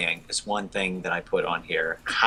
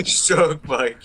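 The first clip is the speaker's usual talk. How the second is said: laughing; 6 semitones higher, 4 dB louder than usual. Real, not acted. Laughing while quoting.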